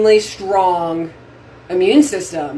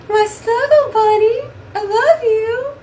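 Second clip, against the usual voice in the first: much higher cooing pet voice; 13 semitones higher than usual. Real, not acted. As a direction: coo up high at the dog. Baby talk to her dog, higher.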